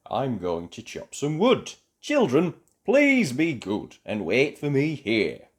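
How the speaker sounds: put-on a father leaving his children. A narrator's reading. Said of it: "Father told Hansel and Gretel".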